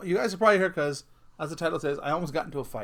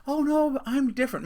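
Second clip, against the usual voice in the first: higher-pitched mock wife promise; imitating wife; 9 semitones higher, 3 dB louder than usual. Husband mimicking his wife's promises, higher-pitched mock insistence. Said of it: "she'll say things like".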